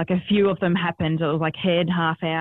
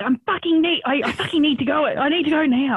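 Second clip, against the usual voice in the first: urgent demand; 7 semitones higher than usual. Retold or imitated. Labouring mother demanding hospital.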